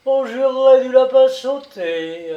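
high, loud and merry; very cheerful. A narrator's reading. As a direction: chime in gleefully. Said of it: "ajouta Lénian très gai".

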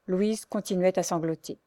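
ordinary delivery, nothing marked

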